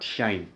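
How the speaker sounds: "Shine" with an opening sh; pronounced "shine". Shown as a demonstration. Teacher answering own question on pronunciation, "Shine" with sh. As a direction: answer with "Shine", one syllable rhyming with sine. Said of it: "You pronounce it as what?".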